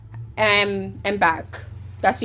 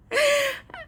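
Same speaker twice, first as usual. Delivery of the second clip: high squealing laugh; real laugh breaking high. Real, not acted. Laughing at retold insult.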